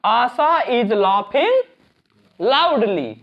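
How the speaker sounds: very slow, each word set apart; slow model sentence for students; slow dictation. Shown as a demonstration. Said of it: "अब हम बस इसकी meaning लिख दें".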